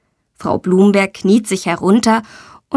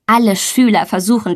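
ordinary delivery

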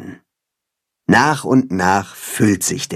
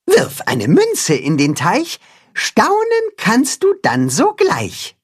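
slow, high recital, rising higher; revealing a verse; 10 semitones higher, slower than usual. A narrator's reading. The cat hen reveals the pond sign's rhyme, reciting. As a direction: recite the sign slowly, like a showy rhyme. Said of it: "verrät das Katzenhuhn".

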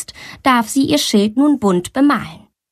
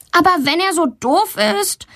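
put-on defensive girl; 9 semitones higher than usual. A narrator's reading. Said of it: "verteidigt sie sich".

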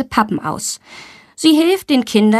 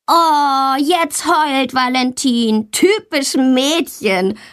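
slow, higher-pitched jeer; taunting call; 4 semitones higher, much slower than usual. A narrator's reading. Boy mocks Valentina's tears, calling out tauntingly. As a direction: drag it out to mock a crying classmate. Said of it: "ruft Tommy".

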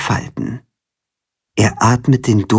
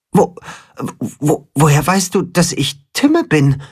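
halting, higher stammer with repeated starts; stuttering; 6 semitones higher than usual. A narrator's reading. Chicken stammering to the cat who knows him. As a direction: trip over the first words in disbelief. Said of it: "stottert das Katzenhuhn".

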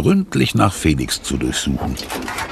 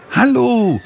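high, drawn-out greeting call. A narrator's reading. Sophie to the museum guard in the schoolyard, calling high. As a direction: call across to him. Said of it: "ruft Sophie".

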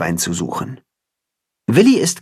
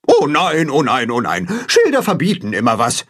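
grumbling; 3 semitones higher than usual. A narrator's reading. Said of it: "meckert Gram kopfschüttelnd".

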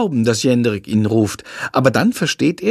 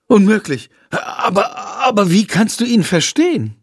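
blurting out; 8 semitones higher than usual. A narrator's reading. Girl to the boy, excited amazed blurt. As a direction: burst out in disbelief. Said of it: "Aus Lilly platzt es heraus".

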